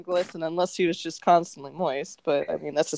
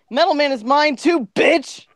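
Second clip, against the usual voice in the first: loud, raised possessive shout; high, loud mock-angry outburst; yelling; 5 semitones higher, 6 dB louder than usual. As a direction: yell the claim across a fight. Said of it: "Gaming yelled".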